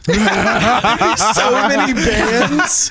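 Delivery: slow, laughing repeat. Real, not acted. Friends joking about clones, laughing. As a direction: laugh through the joke again.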